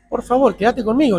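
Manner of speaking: reenacted tense plea; riled pleading. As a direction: plead with rising exasperation, without shouting. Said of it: "me le voy al humo".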